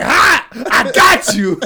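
loud, high gloating yell; a victory yell from on top of the desk; triumphant shout. Retold or imitated. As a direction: crow at him. IRS guy triumphant on desk.

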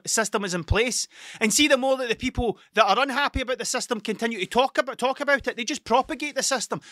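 exasperated rant, pitch riding high; ranty. Real, not acted. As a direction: get carried away ranting about the system. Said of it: "that got a bit fucking ranty there".

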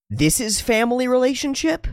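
very high, squeaky dawning question; incredulous question. Acted. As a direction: act a roommate astonished at understanding. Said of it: "he's going".

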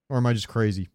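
ordinary talk

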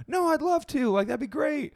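very high-pitched mock gushing; delighted agreement. Older woman agreeing to hold stranger's baby, mock gushing. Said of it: "She's just like".

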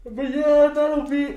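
high, loud sudden sign-off; excited outro. Real, not acted. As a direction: burst into the wrap-up.

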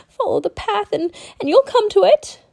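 put-on high, nervous boy voice; frightened village child, pitched high. A narrator's reading. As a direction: blurt directions in a scared high voice. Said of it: "said in a frightened voice".

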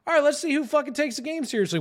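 high, quick, huffy challenge; retold defiant retort. Gamer stung by friends' teasing, defiant and worked up.

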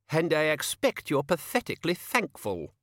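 high, affected servant's voice; sharp-tongued page. A narrator's reading. Page demanding thanks from old man, condescending. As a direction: needle him as the king's snooty page.

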